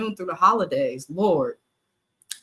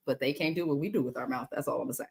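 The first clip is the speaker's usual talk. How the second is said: muttered; 5 semitones lower, 8 dB quieter, faster than usual. Real, not acted. Risque innuendo muttered low.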